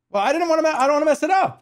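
high, loud panicky protest; frantic high worry. Real, not acted. Streamer yelping at chat not to ruin the meat. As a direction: yelp it out, scared of ruining it. Worried about ruining the meat.

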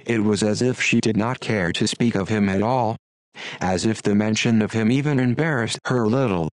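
usual speaking voice

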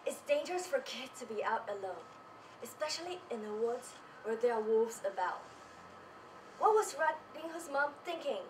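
usual speaking voice

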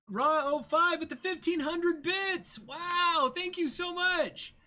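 high, loud, slowed-down gushing shout; thrilled shout of thanks, drawing the words out; excited thanks. Real, not acted. Streamer thanking a viewer for bits, excited high shout.

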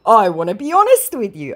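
mock-earnest confession; mock scammer voice. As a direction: scammer opening up, hammed up.

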